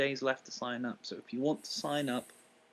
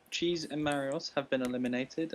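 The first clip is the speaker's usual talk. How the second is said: usual speaking voice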